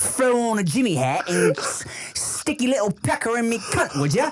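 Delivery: high, bawdy cockney caricature; mock British accent. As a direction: proposition in cartoon British.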